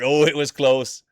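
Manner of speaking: a sudden loud gasp of excitement; excited. Real, not acted. Streamer calls a close finish, loud and excited.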